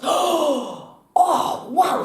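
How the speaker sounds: high-pitched acted wonder; amazed. Barman reacts to cowboy's trick shot, amazed. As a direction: marvel at it, pitch soaring.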